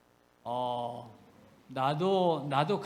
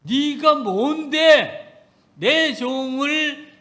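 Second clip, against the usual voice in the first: louder, slow, drawn-out reproach; stern rebuke; 4 semitones higher, 7 dB louder, slower than usual. Retold or imitated. Preacher voicing God rebuking Miriam, slow and loud. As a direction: draw it out like God's judgment.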